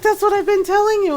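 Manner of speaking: high-pitched mock exasperation; exasperated. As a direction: protest it high, as if fed up. Retold exasperation at friends.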